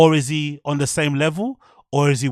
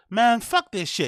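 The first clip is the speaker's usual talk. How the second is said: high-pitched mocking imitation of a comic; mock the comedian's put-on inflection; weird hacky voice; 7 semitones higher, slower than usual. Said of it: "you say in that weird, you know, voice".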